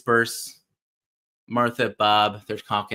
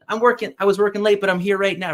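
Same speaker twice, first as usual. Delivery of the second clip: higher, louder and fast; excited Martha; 8 semitones higher, 5 dB louder, faster than usual. Retold or imitated. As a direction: rush it out, bursting with excitement. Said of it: "And she's excited".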